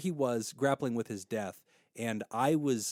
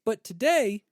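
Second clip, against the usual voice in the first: high, loud, bright-spirited friend voice; friend Josh, upbeat; 13 semitones higher, 8 dB louder than usual. Retold or imitated. Dying friend cheering [name] up, high and loud. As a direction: cheerfully shrug off death and pivot to basketball. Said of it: "he would stop me sometimes and be like".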